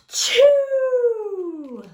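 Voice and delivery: high, loud gasp then a booming sneeze; huge acted sneeze. Wolf sneezing the straw house down with a loud gasp and sneeze. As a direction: build up and explode in a sneeze.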